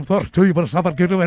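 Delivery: quick, hammed-up mock Scottish accent. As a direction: rattle it off in a jokey fake Scottish accent. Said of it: "that sounds absolute fluent Scottish".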